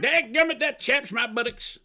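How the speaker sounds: put-on high old-timer grumble; folksy old-timer. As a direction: grumble like a crusty codger.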